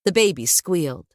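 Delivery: plain reading voice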